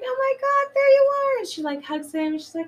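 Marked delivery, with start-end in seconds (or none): 0.0-1.4 much higher, louder squeal of joy; thrilled greeting. Retold or imitated. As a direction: gush at finding him. Woman thrilled to find him.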